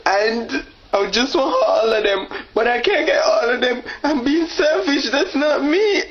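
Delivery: hammed-up weepy voice climbing high; overcome with emotion. Dater confessing on video that he's selfish, weepy. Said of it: "I get emotional".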